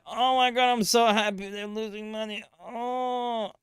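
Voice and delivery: high, mocking voice of smug bears; lampooning a smug doomsayer's victory lap; gloating bears. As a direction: gloat like a bear who called the crash. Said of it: "They were like".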